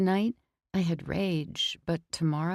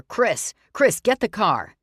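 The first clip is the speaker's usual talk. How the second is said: calling out; 4 semitones higher, 7 dB louder than usual. Acted. Calling out to friend.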